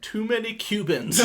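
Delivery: imitating a thick Japanese accent, lightly. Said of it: "in a thick Japanese accent".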